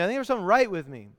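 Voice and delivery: usual speaking voice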